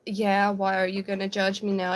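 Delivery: ordinary delivery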